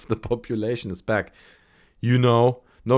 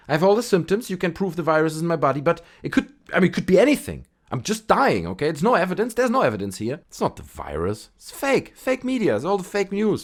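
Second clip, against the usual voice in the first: higher, insistent denier voice; covid denier; 6 semitones higher than usual. Retold or imitated. Vlogger voices a dying patient denying covid in a higher voice. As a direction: play a dying man still insisting it's fake, pitched up. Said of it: "He's like".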